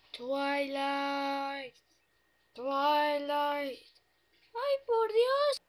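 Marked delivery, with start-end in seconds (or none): 4.5-5.6 high, panicky pony voice; a scared toy pony calling for help; frightened pony. Acted. The frightened pony wants to escape the ghost, high and panicky.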